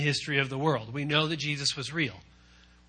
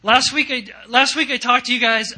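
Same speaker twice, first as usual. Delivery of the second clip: louder preaching; 7 semitones higher, 9 dB louder than usual. Real, not acted. Preacher raising voice.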